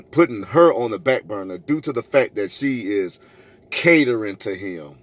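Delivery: ordinary talk, nothing marked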